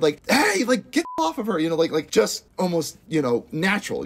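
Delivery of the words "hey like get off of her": high-pitched mock protest, no real shout; mock alarmed call. Viewer reacting to someone getting attacked, mock alarmed call.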